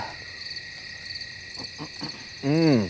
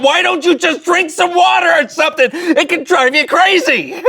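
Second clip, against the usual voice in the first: mock scream; 9 semitones higher than usual. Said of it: "you want to scream out".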